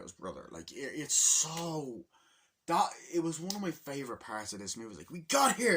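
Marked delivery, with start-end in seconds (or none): 5.1-5.8 high, louder triumphant cry; excited cheer. Retold or imitated. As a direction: shout it in triumph. Brothers arrive, voiced.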